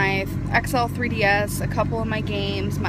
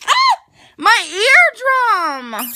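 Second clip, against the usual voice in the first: high, loud yelp of pain; 11 semitones higher, 10 dB louder than usual. Real, not acted. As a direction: yelp like your ear got hurt. Hurt by a loud noise.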